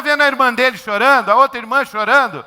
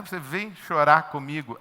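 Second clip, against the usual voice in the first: normal talking voice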